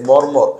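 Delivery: "মর মর", said loud with the syllable repeated; doubled pronunciation. Shown as a demonstration. Teacher giving students another doubled example, doubled pronunciation. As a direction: say "মর" twice over, firmly and loud. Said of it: "উচ্চারণ হয়েছে".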